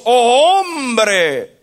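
a high, loud voice of God calling to man; God's reply to man, lightly voiced and lifted; God answering. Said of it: "Y dice Dios".

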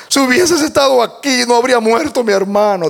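acted lament, pitched high; high, grieving reproach without real sobs; tearful plea. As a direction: raise the voice in a mild mock-weeping plea. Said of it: "dijo llorando".

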